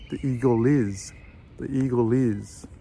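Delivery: "eagle is" said as one word, L carried into "is"; linked eagle is. Shown as a demonstration. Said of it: "join the L sound of eagle with the I".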